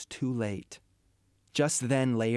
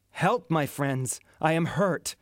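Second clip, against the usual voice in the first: raised cry for help; 5 semitones higher, 4 dB louder than usual. Acted. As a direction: call out, wounded and pleading. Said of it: "cried Claudius".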